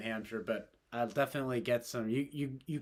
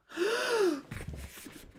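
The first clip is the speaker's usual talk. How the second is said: high gasp. Real, not acted. Reacts to [name] on TV.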